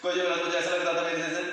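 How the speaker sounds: rushed fast recitation; fast Hindi lines. Shown as a demonstration. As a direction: rattle the line off fast. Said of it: "कैसे कहा था उसके बाद सुन लो".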